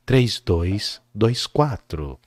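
low, slow ad read; slow late night radio DJ voice; radio announcer voice. Shown as a demonstration.

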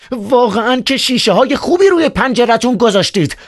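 loud voice. Acted. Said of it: "به صدایی بلند گفت".

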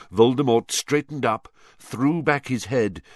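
ordinary delivery, nothing marked

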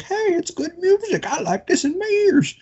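high-pitched goofy fan voice; silly high voice. Retold or imitated. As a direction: squeak it out like a happy fan. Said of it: "be like".

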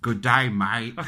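mock Australian greeting; hammed-up Aussie accent.

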